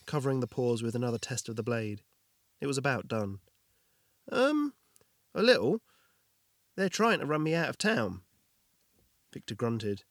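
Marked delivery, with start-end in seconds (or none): 4.3-8.2 high, startled and hesitant; flustered surprise. Acted. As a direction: blurt it out high and caught off guard. Jorgin caught off guard.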